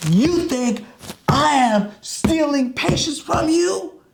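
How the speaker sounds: high, slow, offended outburst; angry accusation. Retold or imitated. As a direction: hurl the accusation, insulted. Doctor pounding on his desk.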